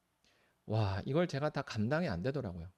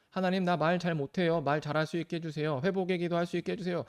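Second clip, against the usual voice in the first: pleading prayer; 6 semitones higher, 5 dB louder than usual. Pretend. Acting out a believer's prayer.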